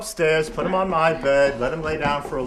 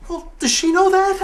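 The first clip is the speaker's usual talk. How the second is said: high, loud, strained question; squeaky, astonished questioning; frantic disbelief; 14 semitones higher, 7 dB louder than usual. Acted. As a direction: ask it in a fluster. Father frantically questions mother.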